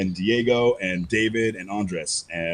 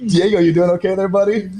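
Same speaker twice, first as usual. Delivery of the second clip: calling out; 10 semitones higher, 7 dB louder than usual. Real, not acted. Calling to a man in pain.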